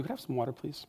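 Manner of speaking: soft, hushed asking voice; a soft plea for water; gentle request. Acted. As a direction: ask quietly, almost murmured. Jesus voiced asking softly.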